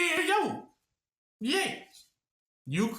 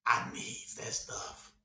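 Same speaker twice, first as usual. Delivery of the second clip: slow, hushed whisper of someone hooked; mock craving whisper; 7 semitones lower, 8 dB quieter, slower than usual. Preacher mimics listener who still wants money, craving whisper. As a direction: whisper it slowly, softly, as a guilty confession.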